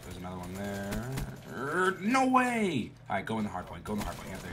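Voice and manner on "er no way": high, loud, stretched-out exclamation; drawn-out disbelief. Real, not acted. Player reacts in disbelief.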